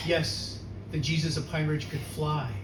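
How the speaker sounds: ordinary delivery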